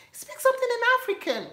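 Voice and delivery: very high, fast, insistent; imitating American classmate. Classmate insisting she speak African, very high and fast. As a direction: press it like a pushy classmate. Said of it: "What is speak something in African?".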